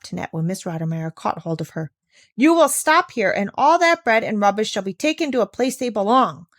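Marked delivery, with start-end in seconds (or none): 2.3-6.5 louder firm command; determined tone. Acted. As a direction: hold the child back, unbending. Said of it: "in a determined tone".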